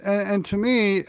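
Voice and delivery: normal talking voice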